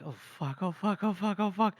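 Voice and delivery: mock panic, under the breath at first; acted oh-no dread; panicked muttering. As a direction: repeat it in a rising, dreading loop. Acted panic at being yelled at.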